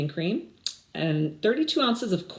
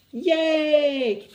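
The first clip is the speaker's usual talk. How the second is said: cheer; 8 semitones higher, 4 dB louder than usual. Real, not acted. Teacher cheers for the ending.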